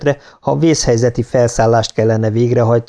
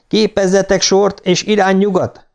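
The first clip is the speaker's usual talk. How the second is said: high-pitched, firm order; commanding; 9 semitones higher than usual. A narrator's reading. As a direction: order the crew into line. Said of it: "parancsolta Louise". (magas hangú, határozott parancs)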